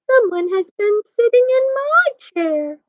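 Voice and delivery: high-pitched Mama Bear; high storybook mother bear; middle-sized voice. A narrator's reading. Mama Bear finds her chair was used, middle-sized high voice. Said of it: "in her middle-sized voice".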